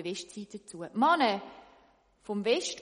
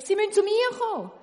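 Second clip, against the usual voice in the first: high, loud call of a name; 12 semitones higher, 10 dB louder, faster than usual. Acted. Baker calling for the chimney sweep, loud call. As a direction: call him over. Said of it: "ruft der Beck".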